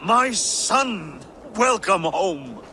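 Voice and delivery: high, loud, glad welcome, slowing at the end; open-armed welcome to a returning son; joyful welcome. Acted. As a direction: greet the son who has come home.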